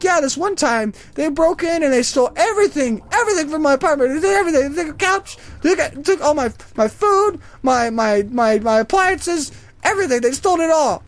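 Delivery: high, aggrieved old lady impression; put-on indignant old lady; elderly woman. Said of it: "it's this old elderly woman".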